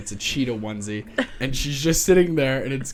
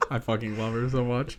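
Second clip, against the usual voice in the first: ordinary talk, nothing marked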